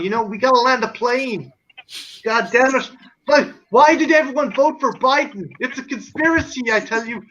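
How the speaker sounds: exaggerated American accent; Irish speaker parodying American speech; put-on American accent. Shown as a demonstration. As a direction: play a ranting American conspiracy theorist. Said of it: "try and do an American accent".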